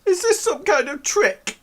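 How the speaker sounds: high falsetto suspicion; wary girl. Acted. Erin told to close her eyes, wary falsetto. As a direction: ask it as a distrustful girl in falsetto.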